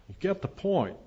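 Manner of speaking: ordinary talk, nothing marked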